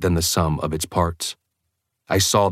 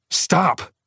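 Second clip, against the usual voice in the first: sharp high panicked cry; 12 semitones higher than usual. A narrator's reading. Alice crying out in panic.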